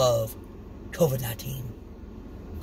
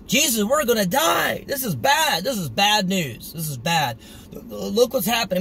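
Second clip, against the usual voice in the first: mock panic, voice flying up; hammed-up we're all gonna die; 1 panicked disciples; 5 semitones higher than usual.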